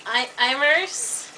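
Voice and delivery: slow, hesitant guess with rising pitch; unsure pronunciation. Real, not acted. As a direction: try the name out as a question. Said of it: "I can't remember how you told me".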